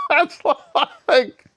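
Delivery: laughing through the words; breaking into laughter; amused laugh mid-sentence. Real, not acted.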